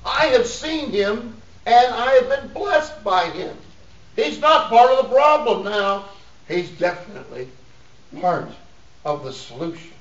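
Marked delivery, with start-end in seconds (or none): 0.0-6.0 high, loud pulpit delivery; raised preacher's voice, stretched key words; emphatic preaching. Acted. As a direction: drive the point home to the congregation.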